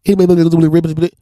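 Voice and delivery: mock speed-talking salesman; fast sales patter. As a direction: rattle it off like a rushed salesman. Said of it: "you're talking too fast for me".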